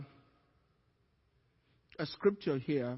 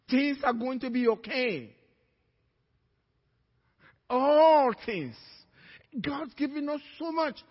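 full-force preaching; 6 semitones higher, 8 dB louder than usual. Real, not acted. Preacher assuring the congregation things will be okay, emphatic preaching. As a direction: preach it out with conviction.

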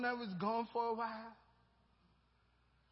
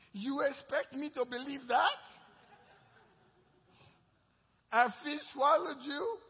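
doubting wife; 6 semitones higher, 8 dB louder than usual. Pretend. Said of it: "assume he had a wife".

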